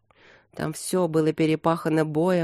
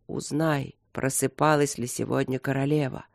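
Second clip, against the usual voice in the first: ordinary delivery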